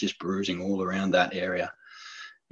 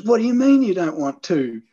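higher, louder surprised question; dad catching on in surprise; 10 semitones higher, 6 dB louder than usual. Retold or imitated. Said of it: "And he goes".

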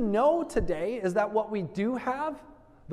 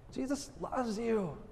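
soft and tender; 10 dB quieter than usual. Shown as a demonstration. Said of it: "Can you do it with this simple message?".

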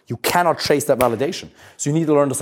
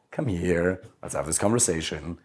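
calm; 6 semitones lower, 8 dB quieter than usual. Pretend. Grounded man approaching upset partner, low soft calm voice. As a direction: lower and softer, steady invitation.

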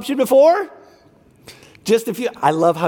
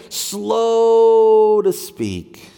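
very slow demo; much slower than usual. Shown as a demonstration. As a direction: stretch every word out like a hand motion. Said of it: "Let's do it together".

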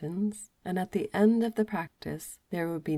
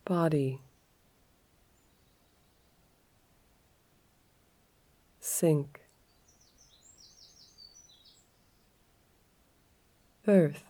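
calm yoga nidra guide, leaving space after each phrase; guided relaxation. Acted.

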